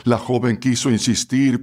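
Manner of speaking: ordinary delivery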